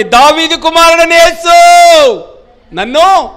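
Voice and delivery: slow, very loud, high shout to Jesus; crying out. Acted. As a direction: cry out desperately for mercy. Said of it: "స్వరమెత్తి, గళమెత్తి".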